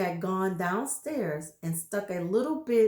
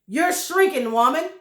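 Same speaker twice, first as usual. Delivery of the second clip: louder, higher jeering; taunting husband; 6 semitones higher, 9 dB louder than usual. Acted. Husband telling wife she shrinks, loud jeer. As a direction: jeer at her loudly. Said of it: "said Mister Twit".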